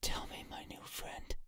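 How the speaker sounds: eerie unvoiced whisper; whispering entity. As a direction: breathe it like a ghost.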